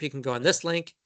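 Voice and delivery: ordinary talk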